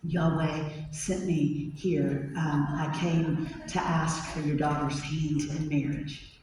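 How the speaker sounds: put-on timid young man asking for a bride; nervous suitor. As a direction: ask meekly, scared of the father. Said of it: "And Hosea goes".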